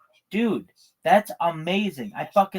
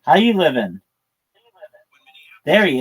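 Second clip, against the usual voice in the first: loud streetwise greeting in a cartoon weasel voice; 2 semitones higher, 7 dB louder, faster than usual. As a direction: call out like the Roger Rabbit weasel, swaggering. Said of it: "that's from Who Framed Roger Rabbit".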